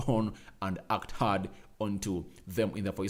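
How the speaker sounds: usual speaking voice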